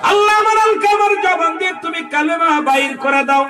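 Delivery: pleading prayer cry. Acted. The Prophet begging God for Alkama, high pleading cry. Preaching at full force.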